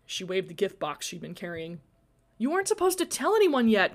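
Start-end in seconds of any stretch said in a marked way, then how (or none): 2.4-3.9 high-pitched protest. A narrator's reading. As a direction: object in a raised pitch. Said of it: "Glory protested".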